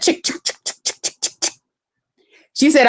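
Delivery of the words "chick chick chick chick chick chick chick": whispered rapid shutter clicks; camera clicks. Retold or imitated. Imitating the friend snapping more pictures, whispered clicks. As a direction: click it out under your breath. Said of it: "she went".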